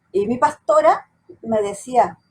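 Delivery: ordinary talk, nothing marked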